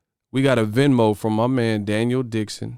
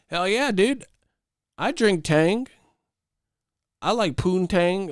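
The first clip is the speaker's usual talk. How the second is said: mock poser voice; 8 semitones higher than usual. Poser trying to sound down, high mocking voice.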